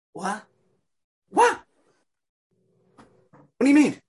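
Haman can't believe his ears; stunned outrage climbing up high; shocked disbelief. Acted. Haman hears the king's order.

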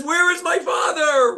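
put-on a terrified boy crying out for his father; frightened shout. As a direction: yell it as a scared child seeing a dog. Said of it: "frightens the young boy".

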